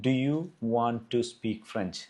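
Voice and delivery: each word spaced out very slowly for students; slow dictation. Shown as a demonstration. Teacher reading the question out slowly for the class to write. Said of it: "ഞാൻ question പറയാം".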